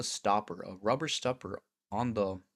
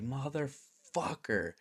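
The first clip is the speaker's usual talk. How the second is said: drawn-out groaned curse; 5 semitones higher, much slower than usual. Retold or imitated. Drawn out curse at bad news.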